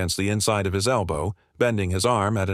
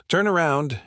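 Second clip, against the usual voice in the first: higher-pitched, controlled command; firm order; 6 semitones higher than usual. A narrator's reading. Officer orders the woman to turn, firm command. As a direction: give a firm order without shouting. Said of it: "he ordered".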